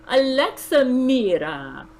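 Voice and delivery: put-on high slow Slovenian accent; drawn-out Slovenian accent call. A narrator's reading. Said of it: "in her thick Slovenian accent".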